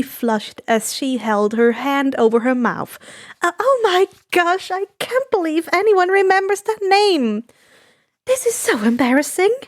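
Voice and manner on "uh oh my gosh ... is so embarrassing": higher, embarrassed giggle voice; flustered and bashful. Acted. Woman flustered, blushing.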